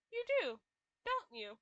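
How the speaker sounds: ordinary talk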